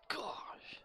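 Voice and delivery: breathless, unvoiced Napoleon Dynamite "Gosh"; a lightly voiced sulky teen sighing "Gosh". Streamer imitating Napoleon Dynamite, sulky and breathy. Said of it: "It's like Napoleon Dynamite".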